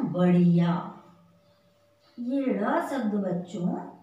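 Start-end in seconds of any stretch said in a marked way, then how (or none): 0.0-1.0 बढ़िया said louder, with a clear flapped ढ़; emphatic model word. Shown as a demonstration. Teacher to children: बढ़िया said loud and clear. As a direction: announce बढ़िया loudly, flapping the ढ़. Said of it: "तो यहाँ हमने क्या लिखा है?".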